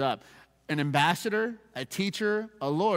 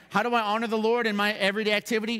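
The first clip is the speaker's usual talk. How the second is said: quick, higher-pitched question; imagined questioner; 6 semitones higher, 4 dB louder, faster than usual. Acted. Pastor voices a believer seeking to honor God, quick higher question.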